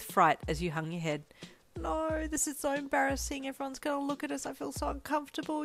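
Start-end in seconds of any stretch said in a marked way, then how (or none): 1.8-5.6 high, thin fretting thought; worried whine, running on in embarrassment; anxious inner panic. A narrator's reading. Shy girl dreads everyone looking at her, anxious and high-pitched. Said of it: "as the anxiety started to knot up".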